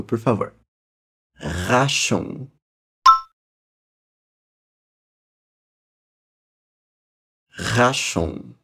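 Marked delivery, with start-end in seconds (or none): 1.4-8.5 "Raschung" with a gargled back-of-throat R; "Raschung" with a rough uvular R; rasped German R. Shown as a demonstration. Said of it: "bem rasgado, gargarejo sem água".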